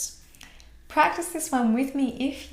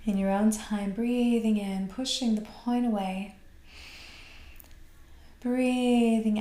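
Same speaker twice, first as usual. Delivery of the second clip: guided breathing exercise. Acted.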